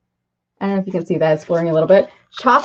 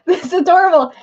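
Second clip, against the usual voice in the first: high giggle into the words; much higher, laughing as you speak; laughing delight; 9 semitones higher, faster than usual. Real, not acted. Laughing at the gift.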